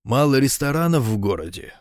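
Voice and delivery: ordinary delivery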